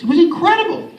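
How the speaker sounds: ordinary talk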